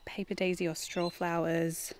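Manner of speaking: ordinary talk, nothing marked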